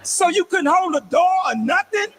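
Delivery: exasperated complaint. Retold or imitated. Dad complaining to daughter.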